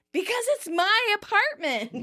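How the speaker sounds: high, drawn-out mock yell; imitating an exasperated shout. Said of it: "Monica's still shouting".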